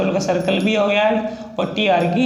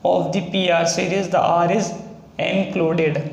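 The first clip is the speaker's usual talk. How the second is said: reads the rule out very slowly, word by word; slow dictation pace, each word spaced out; slow, careful recitation of the rule; slow rule reading; much slower than usual. Shown as a demonstration. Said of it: "बोला गया है".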